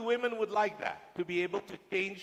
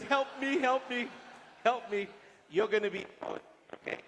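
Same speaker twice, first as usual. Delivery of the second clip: higher pleading help me, lightly hammed-up; mock plea for help; 5 semitones higher than usual. Woman begging the guy beside her, mock pleading. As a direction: play a woman begging her neighbour to save her. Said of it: "she turns to the guy and says".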